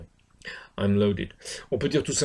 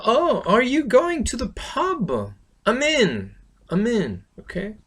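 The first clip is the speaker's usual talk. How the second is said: high, drawn-out acted enthusiasm; eager invitation exchange; 9 semitones higher, 4 dB louder, slower than usual. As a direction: play it keen, pitched well up. Acting an eager pub invitation exchange.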